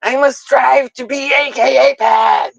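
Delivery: high, shouted melodrama; mock dramatic Peter. Peter demanding love, in a high, hammed-up shout. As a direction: declare it like a pompous hero. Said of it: "And he's like".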